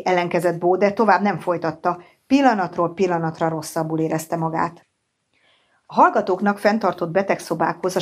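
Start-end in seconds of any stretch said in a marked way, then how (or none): none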